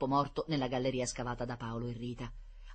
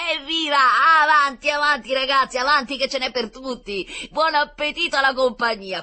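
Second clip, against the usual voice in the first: dawning elation; 7 semitones higher, 7 dB louder than usual. A narrator's reading. Professor lighting up, urging children on, growing elated. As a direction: let it dawn, then rally everyone jubilantly. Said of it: "si accese come una lampadina".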